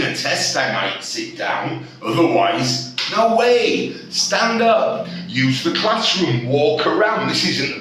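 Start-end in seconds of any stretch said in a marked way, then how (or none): 3.1-5.1 emphatic urging. Real, not acted. Teacher urging staff to stand.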